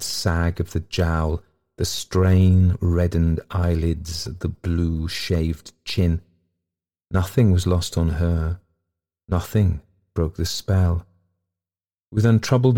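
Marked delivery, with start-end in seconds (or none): none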